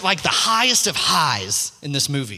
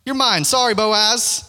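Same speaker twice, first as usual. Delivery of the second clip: higher, louder gloating claim; smug villain; 6 semitones higher, 3 dB louder than usual. Retold or imitated. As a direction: strut in like a cocky movie villain. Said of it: "the villain ... bursts onto the scene and is like".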